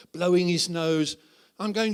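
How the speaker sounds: ordinary talk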